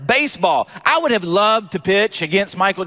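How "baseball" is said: higher-pitched, quick casual quote; imitated athlete. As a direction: lightly voice a player deciding on a whim. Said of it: "he's like".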